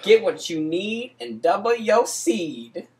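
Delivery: ordinary talk, nothing marked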